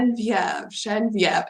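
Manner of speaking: GENEVIEVE said "zhun-VYEV", soft zh; French pronunciation. Retold or imitated. Repeating the host dad's name for his wife, French pronunciation. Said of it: "that's the French word".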